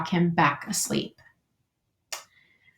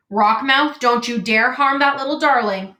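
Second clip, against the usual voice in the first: raised, loud protective warning; fierce scolding; 4 semitones higher, 4 dB louder than usual. Acted. Old goose confronts the pike, fierce scolding. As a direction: warn off an attacker, voice lifted.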